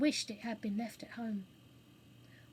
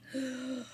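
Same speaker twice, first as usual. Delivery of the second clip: deep audible intake of breath; sharp gasp. A narrator's reading. Audience shocked by the bird's outburst, deep gasp. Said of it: "A deep breath".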